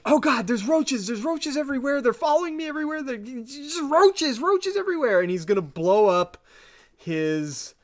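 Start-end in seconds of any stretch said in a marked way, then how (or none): 0.0-5.2 high, frantic mock-paranoid rambling; paranoid scientist. Eckerly rants to Mulder about roaches, high and frantic. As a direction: ramble like a man losing his mind over bugs. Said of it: "he's like".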